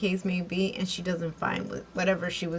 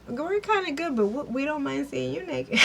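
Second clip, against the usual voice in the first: giggly tease; 6 semitones higher than usual. Retold or imitated. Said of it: "they were kinda like".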